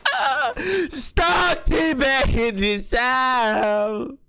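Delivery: high, long drawn-out mock wail; hammed-up crying. Scared player begging to get out, hammed-up sobbing wail. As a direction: wail it out slowly, stretching every word. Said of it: "why am I tearing up".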